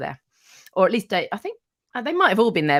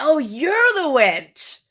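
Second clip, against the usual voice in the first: slow, high mock delighted recognition; 8 semitones higher, 6 dB louder, much slower than usual. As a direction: stretch it out, sweetly amused. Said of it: "he spun round and went".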